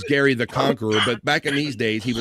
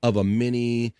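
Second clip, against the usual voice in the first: normal talking voice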